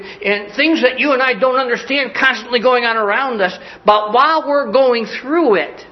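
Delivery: high-pitched, rolling, insistent build; fervent preaching. Real, not acted. Preacher warning the congregation that storms are coming, fervent rolling delivery. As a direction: preach through the list of troubles with gathering force.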